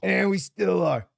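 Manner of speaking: put-on slowly, like a doddering old man; lightly voiced old man; old person voice. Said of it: "old person voice".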